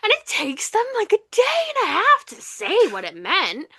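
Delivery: high, loud exasperation; exasperated emphasis. Real, not acted. Complaining they wait a day and a half, loudly exasperated. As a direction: raise the voice at the absurd delay.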